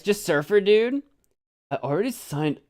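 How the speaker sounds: normal talking voice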